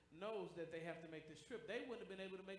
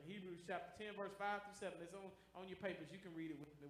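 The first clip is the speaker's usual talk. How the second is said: normal talking voice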